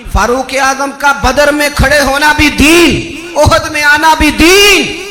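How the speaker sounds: loud, high chanted-style litany; roaring pulpit climax; full-force preaching. Real, not acted. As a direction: hammer out each phrase, landing hard on the last word.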